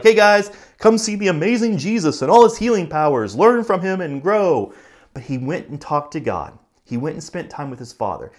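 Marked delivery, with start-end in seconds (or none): 0.0-4.7 higher, louder huckster pitch; hammed-up showman. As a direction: sell the amazing Jesus like a carnival barker. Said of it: "and been like".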